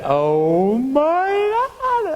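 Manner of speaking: high, very slow oh my God; mortified, long and high; drawn-out shock. Retold or imitated. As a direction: stretch it out in disbelief. Reacting to being caught out.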